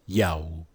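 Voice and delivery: Yaob said low as "yow-b"; guttural pronunciation. Shown as a demonstration. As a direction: sink Yaob into a deep "ow" glide. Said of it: "when you pronounced that word".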